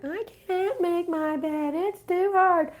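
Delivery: high, drawn-out mock whine; whining child. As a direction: whine it like a small kid who won't budge. Voices a whining child refusing.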